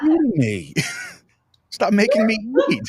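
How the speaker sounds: high, laughing disbelief; retold exasperated laugh; laughing protest. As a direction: laugh through the complaint. Retelling his laughing protest.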